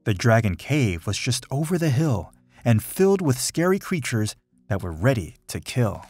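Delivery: ordinary delivery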